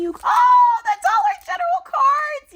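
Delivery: very high, loud scream of joy; ecstatic shriek. Real, not acted. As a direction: shriek at the surprise gift. Discovers the cards.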